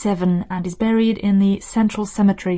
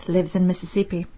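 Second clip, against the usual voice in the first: ordinary talk, nothing marked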